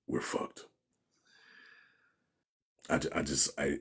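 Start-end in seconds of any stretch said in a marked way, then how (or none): 0.1-0.7 whispered in defeat; resigned whisper. Retold or imitated. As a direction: breathe it out, giving up. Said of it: "where I just said".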